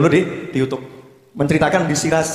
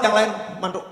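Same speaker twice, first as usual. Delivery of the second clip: ordinary talk, nothing marked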